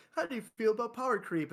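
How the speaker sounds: high, chirpy text-to-speech read; TikTok voice. Retold or imitated. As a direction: sound like the TikTok narrator voice. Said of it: "have the TikTok voice".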